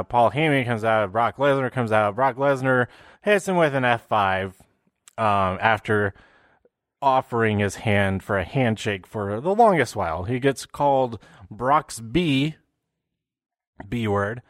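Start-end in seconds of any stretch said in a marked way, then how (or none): none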